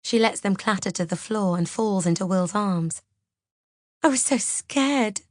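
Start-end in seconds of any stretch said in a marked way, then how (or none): 4.0-5.2 high, slow tearful wail; drawn-out tearful confession; sobbing. A narrator's reading. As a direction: sob it out in relief and fear. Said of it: "she sobs".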